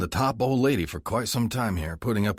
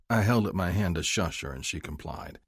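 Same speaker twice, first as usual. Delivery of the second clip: ordinary delivery, nothing marked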